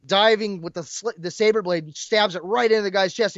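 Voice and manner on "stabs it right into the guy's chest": ordinary delivery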